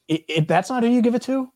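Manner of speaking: high, exasperated insistence; frustrated. Real, not acted. Frustrated rant about play call.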